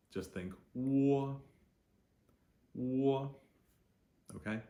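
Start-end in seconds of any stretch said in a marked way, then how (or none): 0.7-3.3 WO as "waw", high and level. Shown as a demonstration. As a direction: hold WO loud like a New Jersey vowel. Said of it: "how the O is pronounced".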